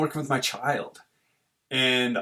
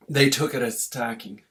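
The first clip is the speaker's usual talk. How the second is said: ordinary talk, nothing marked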